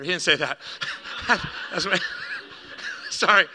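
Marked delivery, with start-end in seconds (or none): 1.3-3.5 cracking up between words; helpless wheezy laughter, apologising through it; laughing. Real, not acted. Preacher laughs at his own offering joke, cracking up.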